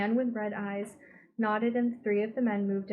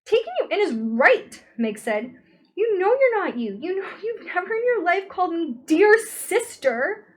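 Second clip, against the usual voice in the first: much higher, loud furious outburst; 10 semitones higher, 11 dB louder than usual. A narrator's reading. Meg angrily confronting her possessed brother. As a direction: lash out at the impostor.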